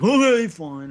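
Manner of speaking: grudging surrender. Retold or imitated. Kid giving in to his bigger brother, grudging. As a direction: give in like a put-upon little brother.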